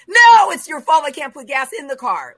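high, loud exasperated outburst; angry retort. Retold or imitated. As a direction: shout it back at the car dealer. Retold angry phone quarrel with dealer.

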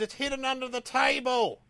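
high, slow parrot tattling. Acted. Parrot ruining the magician's act again, high voice. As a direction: squawk it out like a smug parrot.

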